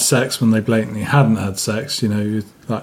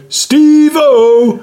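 high, drawn-out hailing shout; imitated call-out; 13 semitones higher, 5 dB louder than usual. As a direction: call the nickname out, mock-greeting. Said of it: "I used to go".

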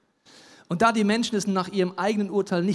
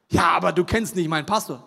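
mock objection; 6 dB louder than usual. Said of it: "congregant objecting".

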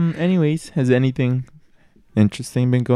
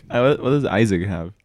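ordinary talk, nothing marked

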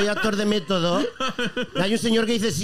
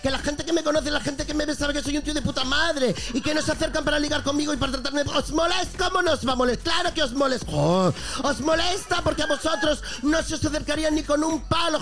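higher-pitched mock streamer rant with a Galician accent; Chocas impression; 4 semitones higher than usual. Streamer mocking his Twitter critics, higher-pitched parody rant. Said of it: "esto es el discurso del Chocas".

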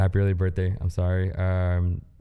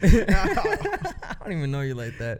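laughing; 11 semitones higher, 4 dB louder, slower than usual. Real, not acted. Joking, laughing through it.

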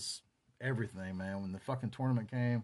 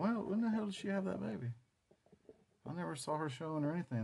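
high-pitched mock bewilderment; puzzled disbelief; 7 semitones higher, 5 dB quieter than usual. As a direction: play a baffled gossip, pitched up. Imagined classmates puzzled about baby.

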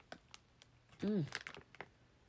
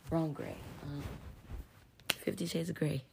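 whispering. Real, not acted. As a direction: whisper it close to the mic.

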